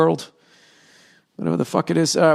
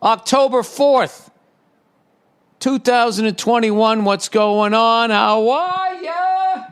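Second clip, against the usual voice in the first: high, drawn-out show opening; sing-song host greeting, higher pitched; podcast intro; 6 semitones higher than usual. Acted. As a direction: belt out the intro, stretching the last words upward.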